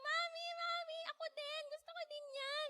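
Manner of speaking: put-on squeaky toddler begging mommy. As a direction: play yourself as a tiny chatty child. Child self quoted as toddler.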